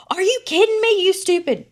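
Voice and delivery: high, loud imitation of a cussing kid; loud, high-pitched mock scolding; mock grown-up outrage. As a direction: exclaim it in hammed-up indignation. Child's imagined grown-up cussing.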